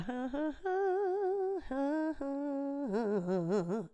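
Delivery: high, drawn-out laugh sliding down; long wavering laugh. Real, not acted. Laughing at oneself before talking on, long wavering laugh. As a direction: let a long, wavering laugh trail away. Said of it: "you can hear it in my nose".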